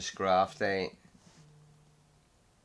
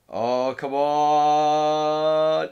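ecstatic shouting; 9 semitones higher, 10 dB louder, slower than usual. Real, not acted. Winning a close game battle.

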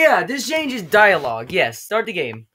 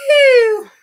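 sudden squeaky voice crack up top; voice cracking high; 11 dB louder than usual. Shown as a demonstration. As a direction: let the voice break into a high squeak. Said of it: "it just goes".

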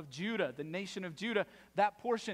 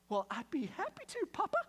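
high, syrupy, put-on child voice; overly willing little helper answering dad; mock-sweet eager child; 9 semitones higher than usual. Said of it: "I will take that message with enthusiasm".

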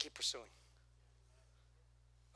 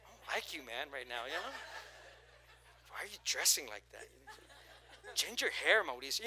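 mock self-scolding. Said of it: "Look at yourself and be like".

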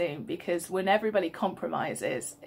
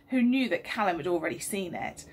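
usual speaking voice

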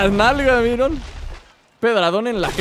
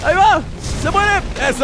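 excited shouting; 9 semitones higher than usual. Real, not acted. Player watching the dinosaur go down, excited shouting. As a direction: shout it out as the kill lands.

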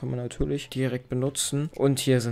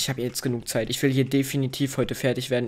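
usual speaking voice

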